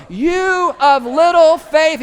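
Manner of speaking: slow, loud, high, drawn out over the wind; yelled rebuke. Retold or imitated. Jesus yells to his panicked disciples over the storm. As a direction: bellow it slowly across the boat. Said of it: "He's having to yell".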